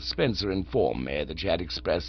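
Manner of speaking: calm, unruffled manservant; Jeeves the valet. Acted. Jeeves replies.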